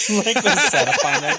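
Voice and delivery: high, loud laughing squeal. Real, not acted. Friend laughs at a ridiculous evolution idea, high laughing squeal. Laughing complaint about Pokemon.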